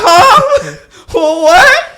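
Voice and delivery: high squeaky mock confusion, laughing; startled huh. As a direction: play dumb with a squeak. Caught by police, startled.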